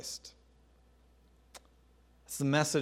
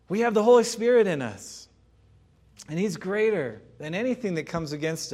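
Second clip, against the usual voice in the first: higher and louder declaration; fervent proclamation; 6 semitones higher, 5 dB louder than usual. Real, not acted. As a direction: proclaim it with fire. Preaching climax.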